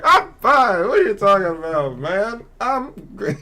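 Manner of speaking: high, loud imitation of a carefree pal; joking daredevil friend. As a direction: holler it back, playfully brushing off worry. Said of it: "he's just joking with everybody".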